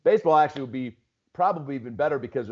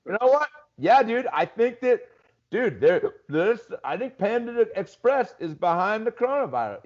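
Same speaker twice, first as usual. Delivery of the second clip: tipsy theorist; 7 semitones higher than usual. Retold or imitated. Comic pushing theories late night after tequilas, tipsy high rant. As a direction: pitch it up, excited, a few tequilas in. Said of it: "late night after a couple tequilas".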